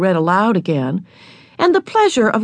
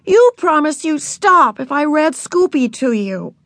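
higher, exasperated protest; crying out in protest; 8 semitones higher than usual. A narrator's reading. Older sister holds little sister to her promise, protesting. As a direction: protest it, pitched up. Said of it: "cried Beezus".

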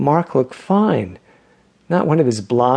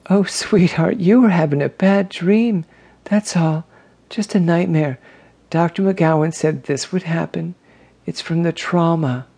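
comforting sister; 6 semitones higher than usual. Acted. Grace hugs Donald after his nightmare, higher soothing voice. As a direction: soothe him like a frightened child.

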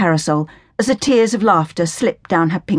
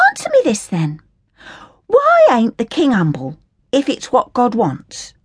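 put-on higher, scornful working-class girl voice; indignant Cockney orphan girl; 5 semitones higher than usual. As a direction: sneer at the Sally Army in a higher, cheeky voice. Hilda challenges Flora.

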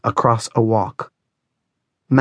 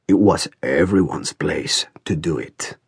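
slow, lower, chilly rebuke; cold old man; 4 semitones lower, 3 dB quieter, slower than usual. A narrator's reading. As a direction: answer coldly and slowly, pitched low. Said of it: "in the same cold way".

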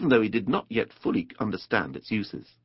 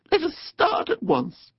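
very high, drawn-out eagerness; eager Mole; slower than usual. A narrator's reading. Mole wants the river trip started, eager. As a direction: plead to be off, squeaky and slow.

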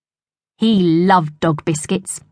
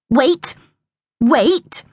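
short, raised-pitch order to a dog; stern command; 6 semitones higher than usual. A narrator's reading. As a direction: hold the dog in check. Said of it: "said Royston sternly".

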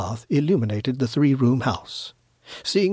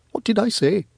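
higher-voiced, innocent protest; protesting young man; 8 semitones higher than usual. Elbowed young friend asks what he said, protesting. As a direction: protest in a higher voice, puzzled at the rebuke.

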